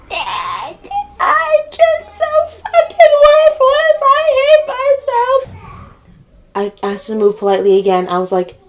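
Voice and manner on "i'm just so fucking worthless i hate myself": slow, very high screechy wail; mock yelling. Mocking the neighbor who kept yelling, high wailing voice. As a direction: caricature her yelling as a drawn-out tantrum. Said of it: "She just kept yelling".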